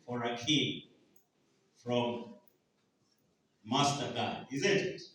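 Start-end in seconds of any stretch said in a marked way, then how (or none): none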